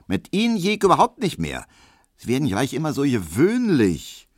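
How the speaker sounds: high-pitched sharp rebuff; pointed, snippy. A narrator's reading. Maid rejects the admirer for getting common, pointedly. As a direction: put him down in a high, pointed voice. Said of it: "sagte Hedwig spitz".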